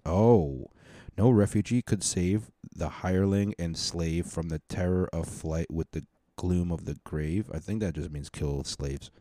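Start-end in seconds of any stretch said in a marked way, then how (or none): 1.2-7.5 measured reading of verse lines; poem recital. Acted. Podcaster reading anthem verses to listeners, measured recital. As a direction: read the stanza steadily, like a recital.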